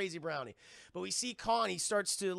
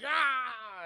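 high, loud drawn-out yell; hammed-up monster cry; freak-out scream; 6 semitones higher, 5 dB louder than usual. Imitating ape freaking out.

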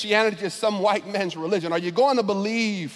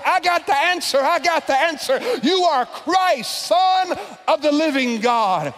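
higher, louder eager shout; yelling out; 6 semitones higher, 3 dB louder than usual. Retold or imitated. Peter shouting his answer to Jesus first. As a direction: blurt it out like the eager student. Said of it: "yells out".

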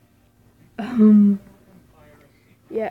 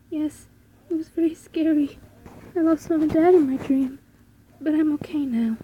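higher-pitched little Dorothy voice; child character after a nightmare; 5 semitones higher than usual. Acted. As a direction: play a child telling Mom about a dream. Voices the child in game dialogue.

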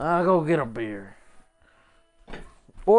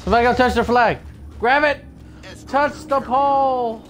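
shouting; 9 semitones higher, 3 dB louder than usual. Real, not acted. Urgent calls during flag match.

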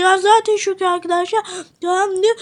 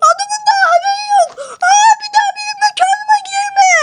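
kızgın Ahmet; 10 semitones higher than usual. Acted.

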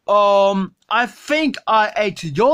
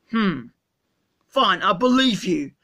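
ordinary talk

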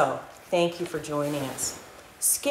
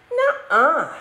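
high, louder playful nuh-uh; kid denial; 8 semitones higher, 5 dB louder than usual. Acted. Skippy fibbing to Mama, cheeky and high. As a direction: deny it like a cheeky kitten. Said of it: "said Skippy".

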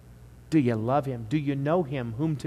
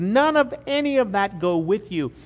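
usual speaking voice

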